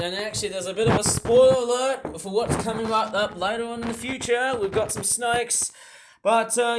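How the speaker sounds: ordinary delivery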